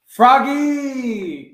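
loud high call across the house. A narrator's reading. Father calling Froggy from the door, loud. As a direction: shout his name to get him moving. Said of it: "called his father".

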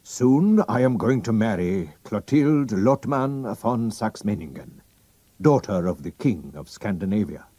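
a hint of a proud royal, pressing; drawing himself up, then urging secrecy; lightly voiced king. The king reveals his name to Holmes, lightly voiced.

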